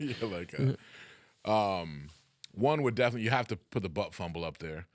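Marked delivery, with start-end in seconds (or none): 0.0-0.1 bursting into giggles; giggly laugh. Real, not acted. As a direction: laugh then say yeah.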